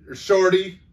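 high, very loud shouted order; snarled command. A narrator's reading. Grandmother confronts intruder with imaginary pistol, snarled command. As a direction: snarl it at full volume to stop him dead. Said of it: "she snarled".

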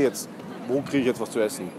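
ordinary delivery

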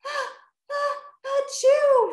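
loud, drawn-out storybook achoo; gasping build-up, then sneeze it out; acted sneeze. A narrator's reading. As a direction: build up to a big, high sneeze. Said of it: "Rocky sneezed".

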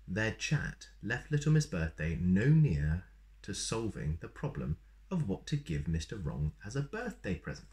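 usual speaking voice

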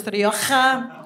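"Rioja" with a showy rasped Spanish j; Spanish pronunciation. Shown as a demonstration. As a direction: overdo "Rioja" as ree-O-kha. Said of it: "I pronounce words in their original accents".

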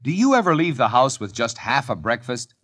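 normal talking voice

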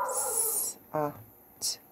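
S, A, T sounded in quick succession. Shown as a demonstration. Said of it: "Let's go a little faster".

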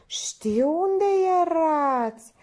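high, slow singsong knowing tease; coy. A narrator's reading. Minerva hinting at his whereabouts, coy. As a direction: draw it out with mock modesty. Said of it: "își lăsă ochii pudică".

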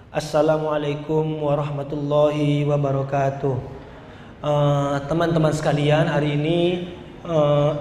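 low, monotone, hesitant opening; nervous presenter. Acted. Coach imitating a poor presenter greeting participants, low and halting. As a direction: mumble a greeting on one low note with stalling fillers. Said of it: "Ada orang presentasi begini".

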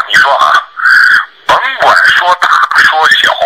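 ordinary talk, nothing marked

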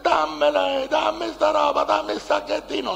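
imitated woman chasing him for the bag, high and demanding; woman demanding back. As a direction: grab it back, pitched high and pushy. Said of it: "La mia correva dietro questa".

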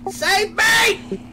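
high panicked cry for help; panicked shout. Real, not acted. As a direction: scream for help. Teammate attacked by mobs.

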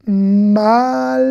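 "malka" with a very long maalll, then ka; drawn-out syllables. Shown as a demonstration. Teacher giving the feminine of king, drawn-out syllables. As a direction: stretch the first syllable of "malka", then land on ka. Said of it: "on va faire consonne plus voyelle".

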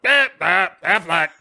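high mock duck squawk; squawking aliens. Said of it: "show up and are like".

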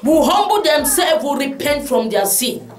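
prayer building to fervent shouted pleas; pastor's impassioned prayer; intercessory prayer. Acted. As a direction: cry out to God with rising intensity. Leads congregation in prayer.

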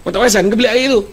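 wife scolding husband about the bathroom; mocking wife. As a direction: mimic the wife's indignant question. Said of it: "Kenapa lah kau tanya soalan ni?".

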